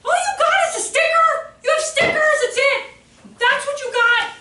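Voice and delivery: high, loud indignant shouting; imitated mad child. Five-year-old offered only stickers, imitated high indignant yelling. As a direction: yell it in outraged disbelief. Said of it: "now I'm so mad".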